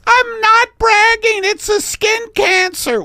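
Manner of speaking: very high, loud falsetto; indignant protest. Acted. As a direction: squeal back in hammed-up outrage. Millie protests in the skit.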